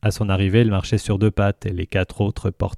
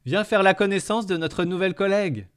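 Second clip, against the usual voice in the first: higher voiced alien woman; character voice; 11 semitones higher than usual. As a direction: lift the voice into the character. Sissix voiced, high.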